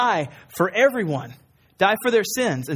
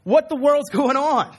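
higher, slower mock outrage; scribes' disbelief; 8 semitones higher, 4 dB louder, slower than usual. Scribes reacting to Jesus forgiving sins, mock disbelief. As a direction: blurt it out in acted disbelief. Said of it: "makes the scribes think".

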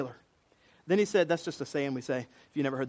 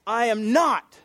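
loud, higher, emphatic denial; sworn denial; 5 semitones higher, 6 dB louder, slower than usual. Retold or imitated. Peter swearing he is not a disciple, forceful denial. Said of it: "with an oath".

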